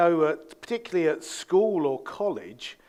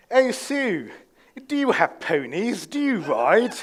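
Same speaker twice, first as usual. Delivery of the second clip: louder posh English lady at the regatta; posh English accent; 5 semitones higher, 5 dB louder than usual. Retold or imitated. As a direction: ask like an upper-crust English lady. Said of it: "she was really posh as well".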